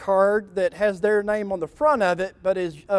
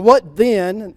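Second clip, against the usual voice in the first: louder, dry sarcastic question; 3 semitones higher, 9 dB louder than usual. Acted. Samuel answers Saul's greeting, louder dry sarcasm. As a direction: make it drip with sarcasm. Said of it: "this is very sarcastic".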